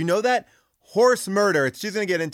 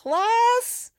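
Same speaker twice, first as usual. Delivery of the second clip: silly squeal; 3 dB louder than usual. Acted. Goofy squeal in ad.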